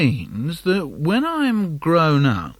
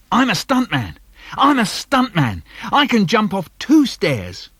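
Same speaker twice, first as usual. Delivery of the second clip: put-on child's boast; 6 semitones higher, 4 dB louder than usual. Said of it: "I say".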